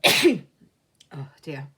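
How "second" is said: sudden loud sneeze mid-word; sneezing. Real, not acted. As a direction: break off into a real sneeze. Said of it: "Sorry, I'm gonna sneeze".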